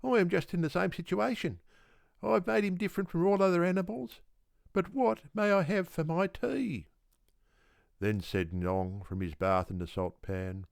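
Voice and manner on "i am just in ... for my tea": high, fast, eager dog voice; hungry Yellow Dog Dingo. Acted. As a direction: rattle it off, starving and impatient. Dingo demands his tea.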